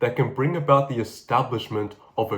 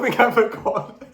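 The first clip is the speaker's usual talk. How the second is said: ordinary delivery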